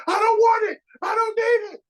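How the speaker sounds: high, loud imitated patient yelling; screaming patient. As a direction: shout it like a patient refusing treatment. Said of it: "people be screaming".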